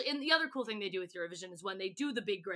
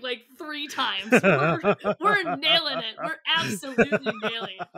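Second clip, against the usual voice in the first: laughing brag; 8 dB louder than usual. Retold or imitated. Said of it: "It's literally like".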